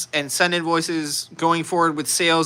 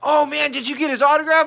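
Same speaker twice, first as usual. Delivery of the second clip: put-on excited kid; 8 semitones higher than usual. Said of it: "one of the kids comes up".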